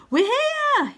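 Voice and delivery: high and loud, calling out; boy shouting on arrival at the cliff; excited shout. Acted. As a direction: shout it out in triumph. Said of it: "he shouts".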